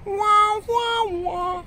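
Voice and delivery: high mock western standoff whistle. Telling of a face mask standoff, a high mock western whistle. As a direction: hum a cowboy duel tune, high and thin. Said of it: "the kind of Mexican standoff".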